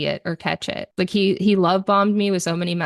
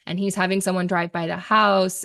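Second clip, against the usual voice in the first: ordinary talk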